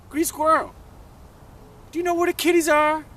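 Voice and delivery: loud, very high-pitched question; insistent questioning. Acted. Toad questioning squirrel about missing kitties, loud and insistent. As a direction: press them, high, loud and quick.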